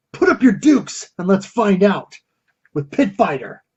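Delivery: punchy arcade-ad announcer read; trailer voice. Acted. Host hyping Pit Fighter like an arcade ad, booming announcer. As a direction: sell it like a nineties game commercial. Trailer-style hype for the game.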